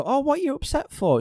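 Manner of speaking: high-pitched dismissive imitation; mock incredulous. Joker answering someone hurt by the joke, dismissive.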